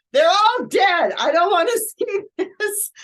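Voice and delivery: high, loud, upset outburst, slowing down; imitating a distressed daughter pushing the video away; retold upset cry, high and loud. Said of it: "so upset".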